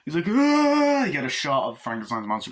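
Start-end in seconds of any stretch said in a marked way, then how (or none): 0.3-1.0 sudden high shriek; retold scream. Glenn shrieking at the monsters, a high yelp.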